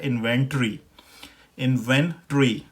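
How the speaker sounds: इन्वेंटरी as in-ven-tree, ending squeezed to tree; British pronunciation. Shown as a demonstration. Teacher introduces British inventory, British pronunciation. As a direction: say इन्वेंटरी with no o before the r. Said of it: "जो British pronunciation है".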